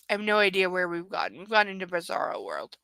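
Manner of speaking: ordinary talk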